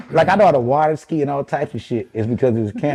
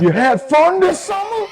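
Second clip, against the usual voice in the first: very high, slow, folksy drawl; imitating family back home; slower than usual. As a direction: ham up the mom offering food. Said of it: "that's how they fucking sounded to me".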